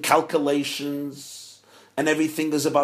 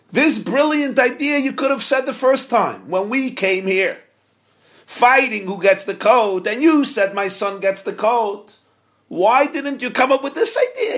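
high, louder incredulous question; complaining old father; 7 semitones higher, 4 dB louder than usual. Acted. Old father questioning the rabbi, higher and louder. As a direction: ask with mock exasperation.

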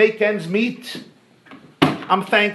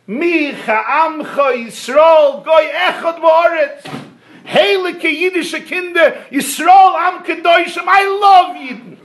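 much higher and louder, slow fervent shout; ecstatic praise; 9 semitones higher, 5 dB louder, slower than usual. Acted. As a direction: cry out in rapturous love for the people. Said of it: "He'll start crying, waxing eloquent".

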